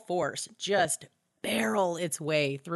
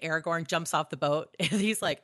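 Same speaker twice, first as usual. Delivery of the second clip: ordinary delivery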